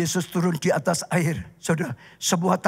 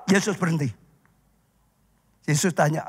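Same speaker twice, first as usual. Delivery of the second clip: ordinary delivery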